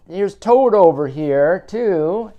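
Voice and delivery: usual speaking voice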